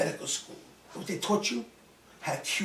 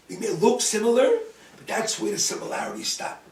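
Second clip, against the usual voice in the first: loud, raised-pitch rebuke; agitated retort; 4 semitones higher, 8 dB louder than usual. Retold or imitated. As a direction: drive the point home forcefully. Agitated quarrel with the doctor.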